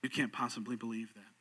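low, soft correction; hushed, low-pitched acted reply; calm rebuttal. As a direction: answer the heckler quietly. Apologist calmly answers the heckler.

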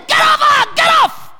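reenacted scream at the spirit; shouted command. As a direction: yell it at the demon with full force. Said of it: "I just shouted".

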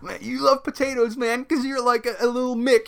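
high mocking dude voice. Mocking the GM's slur at the Irish grandma. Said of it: "he was like".

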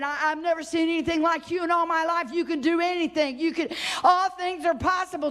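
high, fast, fervent preaching; ecstatic praise. Acted. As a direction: climb into rapturous praise. Job's praise, rising preaching.

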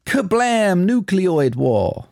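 high, slow dramatic announcement; dramatic film line repeated. Acted. Host repeats the line for co-host, slow and dramatic. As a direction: say it big and slow. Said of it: "It's all in the pronunciation".

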